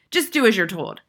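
mildly thundered order; thundering. A narrator's reading. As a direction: lay down the law. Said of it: "the headmistress thundered".

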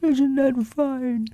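very high, slow little bear voice; mock shout from a tiny bear; Winnie the Pooh. A narrator's reading. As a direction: call down slowly in a small high voice, without shouting. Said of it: "shouted Winnie the Pooh down to you".

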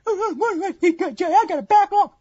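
high, whiny excuse-maker voice; squeaky put-on whine; whiny mock viewer. Mocking an excuse-making viewer.

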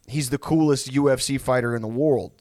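ordinary delivery

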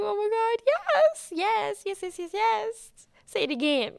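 giddy, delighted yes-yes-yes; gleeful excitement. Retold or imitated. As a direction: squeal yes like you can't get enough. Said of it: "deep inside, I was like".

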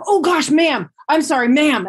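high, loud retold apology; flustered apology. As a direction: imitate an embarrassed stranger blurting an apology. Acts out stranger's flustered apology.

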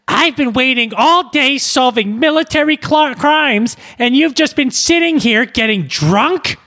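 put-on very high, shrill-pitched nagging older mother; George Costanza's mom. Wife scolding her lazy husband, high nagging mom voice. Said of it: "she talks like George Costanza's mom".

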